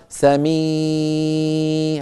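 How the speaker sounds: سمعنا with the kasra stretched very slowly until it turns into a ya sound. Shown as a demonstration. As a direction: pull the mi of سمعنا out into a long ee. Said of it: "مطها". (مدّ كسرة سمعنا حتى تصير ياء)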